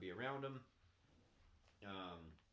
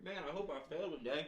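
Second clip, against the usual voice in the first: mock cheerful; 6 semitones higher, 5 dB louder than usual. Mocking someone eager to fail.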